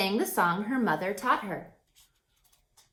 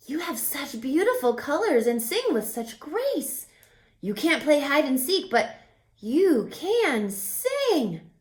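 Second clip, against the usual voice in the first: higher admiring lizard voice; lightly voiced lizards; 6 semitones higher than usual. A narrator's reading. Lizards praise Jazz's singing, lightly voiced. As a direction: praise her gently like a lizard.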